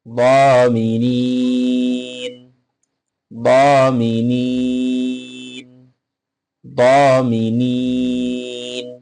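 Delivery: দ মি নিন broken into syllables with pauses; teacher drills দ মি নিন beat by beat. Shown as a demonstration. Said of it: "উচ্চারণটা হবে".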